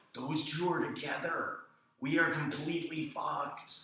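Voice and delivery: high, slow, mock-doomed delivery; retold alarmed realisation. Retold realisation that they are doomed.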